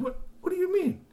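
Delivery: very high baffled question; high confused Tony. Acted. Tony confused by Kashi's plan, baffled. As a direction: squeak out the question.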